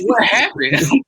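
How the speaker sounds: high, loud, drawn-out disbelief; incredulous. Retold or imitated. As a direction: stretch it out in mock astonishment. Retold baffled reaction to drunken story.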